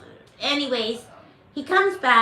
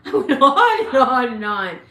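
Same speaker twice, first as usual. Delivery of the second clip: laughing; 8 dB louder than usual. Real, not acted. Laughing at her own ranting.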